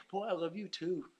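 a hint of a fond father; tender God voice. Preacher voicing God's loving reply.

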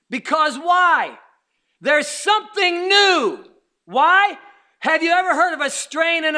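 slow, loud preacher cry; preaching shout. Acted. Preacher crying out to his church. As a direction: call it out to the pews.

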